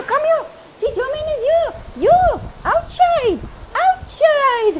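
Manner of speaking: high coaxing pet talk, eager. Real, not acted. Owner calling her dog Champy out into the rain, high baby-talk. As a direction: sing it up high to lure the dog.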